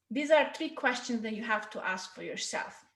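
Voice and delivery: normal talking voice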